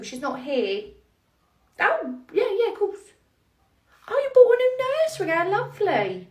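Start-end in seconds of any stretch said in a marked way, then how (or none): 1.8-6.3 fawning phone voice. Acted. Manager fawning to owner on phone.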